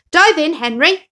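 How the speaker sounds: high, loud cheerful call; friendly call. Acted. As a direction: urge a friend to dive in. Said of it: "said his friend Splash".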